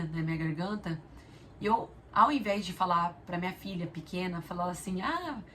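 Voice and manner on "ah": a playful imitation of yelling to a child from afar; mock calling out. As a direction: imitate her old habit of hollering at the daughter. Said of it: "tô gritando alguma coisa".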